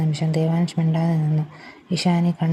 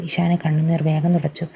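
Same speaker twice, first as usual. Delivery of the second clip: usual speaking voice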